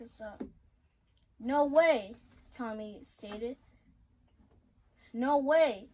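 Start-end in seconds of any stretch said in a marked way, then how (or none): 1.4-2.1 loud shocked exclamation. Acted. Shocked reaction to the fight news. 5.1-5.9 loud shocked exclamation again. Acted. Same exclamation read again.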